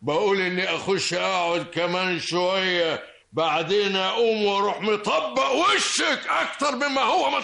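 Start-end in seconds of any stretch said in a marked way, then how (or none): 3.3-7.4 mocking taunt. Insulting quarrel with the aunt.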